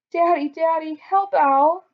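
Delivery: put-on high, loud plea from a scared girl; frightened cry. A narrator's reading. Katie begging Daddy to help the parrot, high frightened cry. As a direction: call out to Daddy in a panic. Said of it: "cried Katie".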